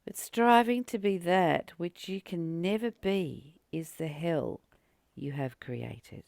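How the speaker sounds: usual speaking voice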